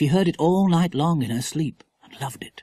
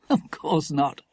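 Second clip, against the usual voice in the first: ordinary delivery, nothing marked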